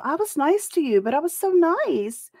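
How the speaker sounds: high-pitched mocking whine; high, exaggerated pleading imitation; mock self-proclaimed nice person. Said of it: "have to tell people".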